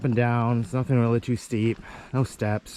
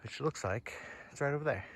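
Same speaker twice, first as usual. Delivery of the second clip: ordinary delivery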